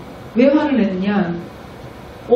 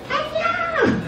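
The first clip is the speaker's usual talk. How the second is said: put-on tiny high child's hello, much softer; little preschooler waving hello; cheerful child greeting; 7 dB quieter, much slower than usual. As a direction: chirp hello like a little kid. Children greeting friends, evangelising.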